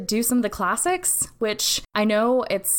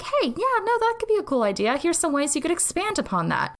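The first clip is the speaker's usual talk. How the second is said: much higher, upbeat friend voice; encouraging friend; 8 semitones higher than usual. Retold or imitated. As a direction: chirp it like an eager supportive friend. Voicing a supportive friend.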